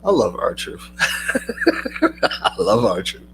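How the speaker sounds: real amused laugh, then words through it; laughing while talking. Real, not acted. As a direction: chuckle into a slow, drawn-out line. Amused by the R-Truth quote.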